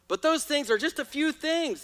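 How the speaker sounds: usual speaking voice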